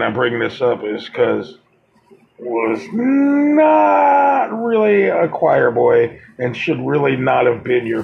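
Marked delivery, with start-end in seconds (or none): 2.4-6.2 high, slow, loud sarcastic stretch; sarcastic emphasis. Real, not acted. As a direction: drag it out like a mocking jab. Mocking the victim's poster image.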